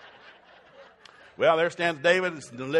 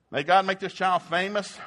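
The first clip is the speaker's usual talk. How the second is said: louder and higher, a proud blessing; proud proclamation; 6 semitones higher, 5 dB louder than usual. Shown as a demonstration. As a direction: proclaim it over the baby with pride. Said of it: "That's a strange thing".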